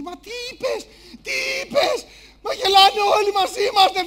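high, agitated rush of reproach; upset family. Acted. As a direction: wail at father in embarrassed protest. Family scolds George in car.

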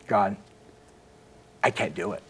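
quiet, low, defeated plea to God; retold prayer, softly giving up; broken man praying. Broken man kneeling to pray downstairs, quiet despair. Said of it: "I'm just a broken man".

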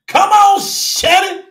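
rallying shout from the pulpit; calling out. Real, not acted. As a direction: call her out, pitched well up. Preacher calls out to congregant.

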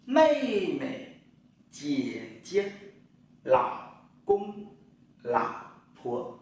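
each word said high and set apart; Chinese tone drill. Shown as a demonstration. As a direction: run through the word list for the class. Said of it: "Chúng ta đi lại thêm một lần nữa nhé".

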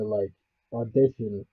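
usual speaking voice

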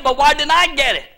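ordinary delivery

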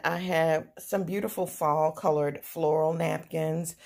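ordinary talk, nothing marked

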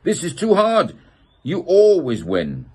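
high, loud frustrated yell; a boy yelling at his brother after losing. A narrator's reading. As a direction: shout it like a kid who just lost. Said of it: "He slams the table in frustration and yells".

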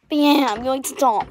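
usual speaking voice

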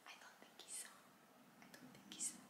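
unvoiced whisper; lady beside him whispering it. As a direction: breathe it as a secret aside. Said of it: "Lady next to him is like".

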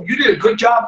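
ordinary talk, nothing marked

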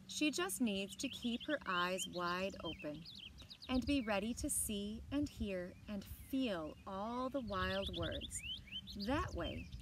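put-on reading a picture book aloud to children; bright, clear read-aloud voice; story narration. A narrator's reading. Teacher reading a book to the class, in a storytelling voice.

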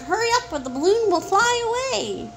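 high-pitched, hurrying call; urgent cry. A narrator's reading. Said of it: "cried the Wizard".

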